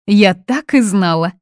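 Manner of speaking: high, loud nervous outburst; agitated. A narrator's reading. Mother fears her son is being taken, agitated. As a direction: blurt it out in agitation. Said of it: "занервничала Фатини".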